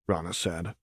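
usual speaking voice

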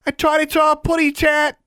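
high, loud cartoonish taunt; mocking call. A narrator's reading. Girl mocking panthers that cannot climb, shouted taunt. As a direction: shout baby talk to mock them. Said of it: "she called mockingly".

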